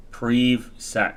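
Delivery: PrivSec as "preev-sack", falling at the end; long-e pronunciation. Shown as a demonstration. Streamer explaining the spelling, PrivSec as "preev-sack". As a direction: give PrivSec an "ee" in the first syllable. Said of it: "there's no E at the end".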